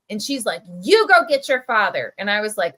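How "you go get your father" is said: angry demand, loud; furious order. Retold or imitated. Manager angrily orders the boy to fetch dad. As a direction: bark the order at the kid. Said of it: "she looks furious".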